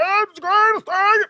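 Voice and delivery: high, loud shrieked excitement; mock scream. Mocking how the wrestler actually yelled. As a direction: shriek it like a giddy fan. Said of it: "instead you're like".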